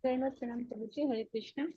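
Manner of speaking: very faint, far from the mic; barely audible. Real, not acted. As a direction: voice barely coming through. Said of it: "Mataji, your voice is very faint".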